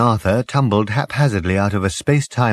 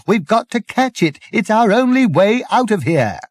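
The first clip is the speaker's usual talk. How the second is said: higher, urgent exasperated shout; 6 semitones higher than usual. A narrator's reading. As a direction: hammer it into his softened brain. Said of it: "shouted Ford at him".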